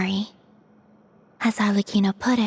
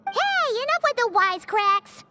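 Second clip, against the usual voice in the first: scolding squeak; 12 semitones higher than usual. Acted. Small companion scolds the wisecrack.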